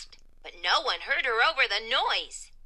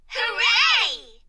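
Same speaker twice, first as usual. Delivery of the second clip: high, loud hooray falling at end; excited cheer; 13 semitones higher, 6 dB louder than usual. A narrator's reading. Story's exclamation in the classroom, high loud cheer. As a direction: cheer it out at full voice.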